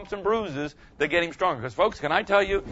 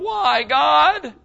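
high, louder plaintive whine to God; a petulant "Why, God?" flung upward; whiny complaint; 9 semitones higher, 5 dB louder than usual. Retold or imitated. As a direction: complain like a hurt child. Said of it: "instead of going".

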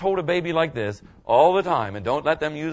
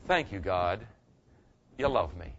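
soft, low and quiet thanks to God; quiet sincere prayer of thanks; grateful thanks; 10 semitones lower, 8 dB quieter than usual. Shown as a demonstration. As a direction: say it gently, in humble gratitude. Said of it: "you can say".

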